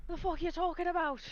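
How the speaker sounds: high, loud Irish indignation; cussing Irish accent. Shown as a demonstration. Player finding the accent through cussing, loud Irish. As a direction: swear it in a thick Irish brogue. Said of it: "cussing gets me back into the Irish accent".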